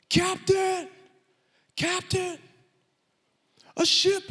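high, loud cry from the crow's nest; lookout's shout. Acted. Lookout shouting down to the captain, high and loud.

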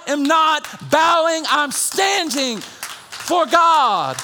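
high, loud shouted preaching; full-force preaching. Real, not acted. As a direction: lift the church with a shout.

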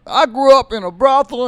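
high, loud Pryor impression; raw Pryor line. Teller quotes Pryor's stage opener, high and loud. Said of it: "just telling this raw, nasty".

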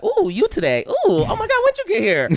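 very high, giddy mock swoon; high-pitched flirty surprise; excited crush. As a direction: squeal with delight at spotting a crush. Acting out spotting a new crush.

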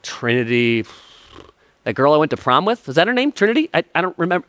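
guessing at a word he never heard; clueless thief. Retold or imitated. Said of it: "He's like".

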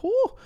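very high-pitched "whoo"; startled whoo. Retold or imitated. Retelling his shock at the hooked goldfish, startled whoo. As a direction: let out a high hoot of shock.

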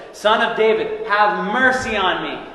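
loud, high plea; crying out. Acted. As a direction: call out for mercy with full voice. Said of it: "This blind man said".

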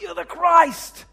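high, loud and slow, bursting with conviction; excited declaration. Retold or imitated. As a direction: proclaim it like a sudden revelation. Peter's excited declaration retold.

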